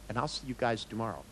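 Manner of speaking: ordinary delivery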